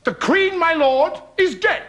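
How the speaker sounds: high, declaimed tragic line; hammed-up Shakespearean herald; stage doctor's announcement. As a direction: proclaim the news to the king. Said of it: "I have to say".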